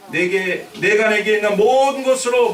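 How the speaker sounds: loud, emphatic verse declamation; scripture reading. Acted. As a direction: proclaim the verse to the congregation. Reads the scripture aloud.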